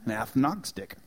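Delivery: "agnostic" said with a lisp, "æfnagstic"; quiet lisping "agnostic". Retold or imitated. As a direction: lisp through "agnostic" like a th or f sound. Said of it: "It's because of his lisp".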